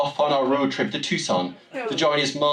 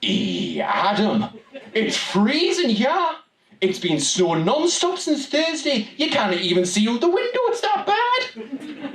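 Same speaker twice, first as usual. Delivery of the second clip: high, slow Geordie mam complaining; 9 semitones higher than usual. Retold or imitated. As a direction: imitate mum moaning about the snow in a strong Newcastle accent. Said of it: "My mam was her usual self, complaining".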